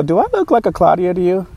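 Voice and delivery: high-pitched mock disbelief; incredulous retort. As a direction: throw the question back, pitched well up. Retorts to canvasser's wrong-name guess.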